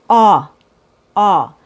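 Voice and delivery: Aw with the jaw dropped and lips rounded, said loud; loud clear vowel demo; aw sound demo. Shown as a demonstration. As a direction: open round Aw, held out. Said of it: "drop the jaw and make the bottom lip round".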